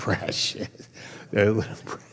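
Precious whispered with a laugh, Gollum style; laughing Gollum hiss. Retold or imitated. Pastor repeating the hobbit impression, whispered hiss.

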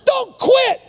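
very high, shouted command; emphatic shout. Real, not acted. Preacher urging the congregation, emphatic shout. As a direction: cry it out at the top of your range.

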